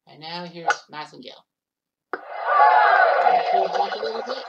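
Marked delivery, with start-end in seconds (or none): 2.1-4.4 slow, loud, high plea to the ball; will the ball back with a drawn-out shout; coaxing the ball, louder and higher; urging the ball. Real, not acted.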